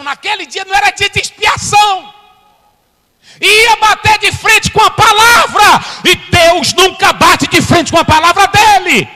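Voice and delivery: high, loud, slowed shouting; full-force preaching. Acted. Preacher to congregation, shouting the message. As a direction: thunder it over the congregation.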